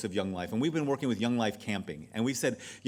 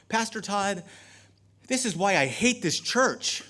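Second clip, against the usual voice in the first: laughing exasperation; 8 semitones higher, 5 dB louder than usual. Retold or imitated. Woman exasperated at pastor.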